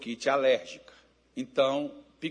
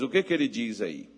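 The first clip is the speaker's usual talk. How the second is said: ordinary delivery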